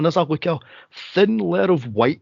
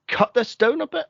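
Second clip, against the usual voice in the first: much higher pleading line; mock exasperated plea; 10 semitones higher than usual. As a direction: squeak it up high, fed up. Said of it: "And I'm like".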